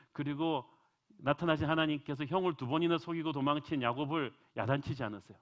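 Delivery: ordinary talk, nothing marked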